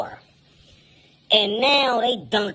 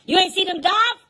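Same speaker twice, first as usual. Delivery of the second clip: high, mocking voice of the older lifeguard; mocking older guy; 12 semitones higher than usual. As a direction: tease him, high and sly. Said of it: "He go".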